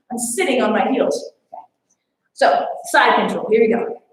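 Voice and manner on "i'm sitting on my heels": normal talking voice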